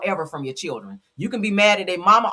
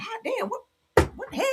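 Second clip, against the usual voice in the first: high mock shock; 4 dB quieter than usual.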